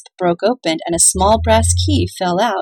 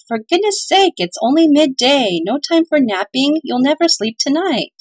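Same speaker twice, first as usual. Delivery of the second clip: high, loud sharp mother; sharp scolding; 6 semitones higher, 3 dB louder than usual. A narrator's reading. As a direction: scold the sleepy kids sharply. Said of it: "Mother spoke sharply".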